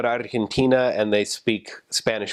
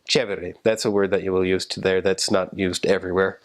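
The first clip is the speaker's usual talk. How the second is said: ordinary delivery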